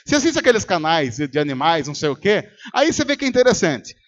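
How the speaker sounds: usual speaking voice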